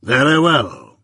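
measured, stern lawyer, lightly voiced; Jaggers. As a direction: lay it down slow and firm.

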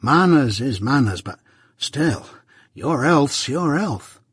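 slow, earnest country fellow; mildly aghast. A narrator's reading. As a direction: plead good sense, a touch aghast. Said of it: "all aghast".

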